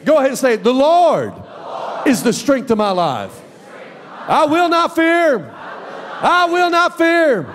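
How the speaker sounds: shouted congregational confession; call-and-response declaration. Shown as a demonstration. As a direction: lead the church in declaring it. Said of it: "say that with me".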